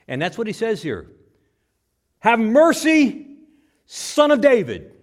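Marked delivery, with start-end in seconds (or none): 2.2-5.0 loud, higher, slow demanding cry; a loud high demanding shout; shouted command. Shown as a demonstration. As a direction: order him to show mercy. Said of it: "Not as a request, but as a command".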